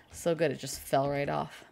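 normal talking voice

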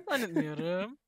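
normal talking voice

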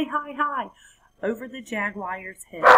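ordinary delivery, nothing marked

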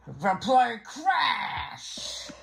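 high, slow drawn-out shout; big slow crash shout; dramatic shout. Pretend. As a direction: announce the crash like a disaster.

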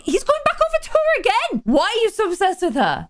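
high-pitched, indignant outcry; exasperated alarm. Real, not acted. Player sees him going back to Luna, exasperated alarm. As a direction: protest in high-pitched disbelief.

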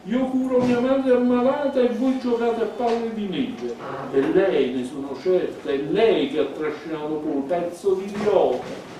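high, loud furious scolding; angry yelling. Acted. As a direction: tear into the boys at full voice. Elisabetta keeps yelling at them. (rimprovero urlato, acuto)

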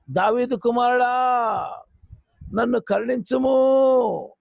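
loud, slow desperate cry. Acted. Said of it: "అని కేకలు వేసింది". (మరింత గట్టిగా కేక)

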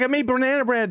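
high mock mom; chirpy eager mother. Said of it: "She's like".

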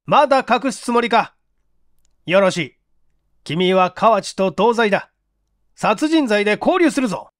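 stern police chief threatening. Acted. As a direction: threaten the suspect with arrest.

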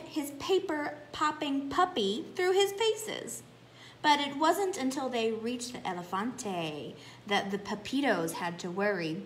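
usual speaking voice

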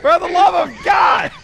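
high loud relieved yell; exasperated shout. Real, not acted. Exasperated at lost friend.

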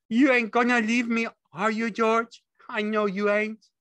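high, loud, anxious simpleton; pleading Lenny. A narrator's reading. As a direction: beg him not to go. Lenny begs George.

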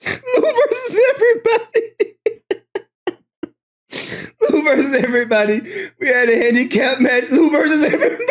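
talking through a high, wheezing laugh; barely able to speak for laughing; laughing to tears. Real, not acted. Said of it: "Oh, I'm crying right now".

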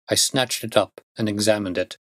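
ordinary delivery

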